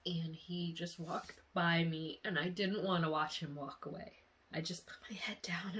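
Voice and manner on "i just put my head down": soft whispered memory; hushed whisper. A narrator's reading. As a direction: tell it in a hush. Sad moment in dream.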